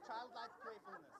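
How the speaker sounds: put-on high, soft childlike voice; childlike playfulness. Shown as a demonstration. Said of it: "let me demonstrate childlike playfulness".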